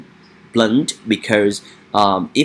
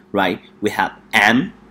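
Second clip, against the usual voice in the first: ordinary talk, nothing marked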